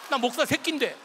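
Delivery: snapped back loud and high; loud retort. Retold or imitated. Pastor shouting back at the cursing deacon, loud retort. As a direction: fire the line right back at him.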